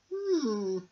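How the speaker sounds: high, fast, pert little bee; clever little bee. Acted. Amelia the clever bee quizzes Norman, high and quick. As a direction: question him like a sharp little know-all. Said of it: "said Amelia".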